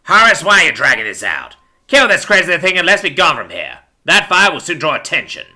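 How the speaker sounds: crooked patrolman losing patience; impatient pig cop. A narrator's reading. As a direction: push your partner to get it over with. Pig urges Horace on.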